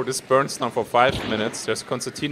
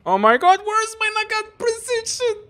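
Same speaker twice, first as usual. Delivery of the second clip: high crying wail, the last line dragged out slow; desperate high-pitched cry; panicked wail; much slower than usual. Real, not acted. Panicked when enemies appear.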